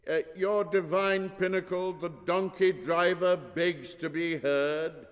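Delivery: very loud announcement. A narrator's reading. Manservant into old priest's ear, very loud. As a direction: announce it as to the hard of hearing. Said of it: "speaks in a very loud voice".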